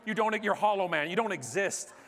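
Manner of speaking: ordinary delivery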